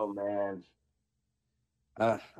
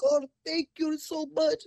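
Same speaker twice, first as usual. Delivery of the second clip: very high, slow, sugary thank-you; mock grateful lady; slower than usual. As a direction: squeak it out like the grateful customer. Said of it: "she's like".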